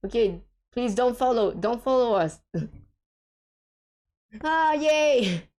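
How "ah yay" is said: high, loud happy yay; a bright burst of joy; excited cheer. Real, not acted. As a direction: cheer it out in delight. Celebrating a game win.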